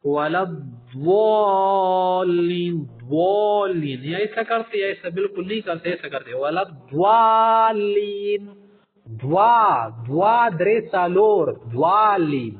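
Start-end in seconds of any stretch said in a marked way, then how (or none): 0.0-4.0 الضالین chanted very slowly and loud, ض rounded toward waw; wrong recitation demo. Shown as a demonstration. The teacher imitates the exaggerated recitation some people use. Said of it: "وہ کیا کرتے ہیں؟". 6.4-12.5 الضالین loudly drawn out with a waw-like ض; wrong recitation demo. Shown as a demonstration. The teacher loudly shows students a mistaken form. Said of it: "ضالین بھی نہیں ہے".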